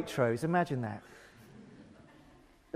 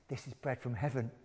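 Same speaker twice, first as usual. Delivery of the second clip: much softer, marvelling; awed hush; 6 semitones lower, 14 dB quieter than usual. Acted. [name] telling the neighbour about the bread, hushed awe. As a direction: breathe it out in wonder. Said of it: "I said".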